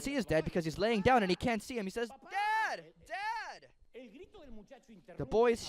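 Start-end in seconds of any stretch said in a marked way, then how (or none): 2.3-3.7 put-on high child's voice calling for his dad; boy calling out. Bound son calling to his father, high child's call. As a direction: call out as a small boy who can't see his father.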